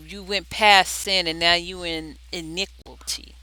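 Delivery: usual speaking voice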